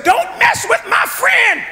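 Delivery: put-on a tiny kid standing up to the bullies; squeaky little voice. Tommy defending Emmanuel from the bullies, high squeaky shout. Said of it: "a very squeaky little voice".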